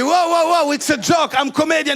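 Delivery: high, loud alarmed protest; panicked protest. Retold or imitated. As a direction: wave it off fast, desperately. Panicked backpedalling to guard.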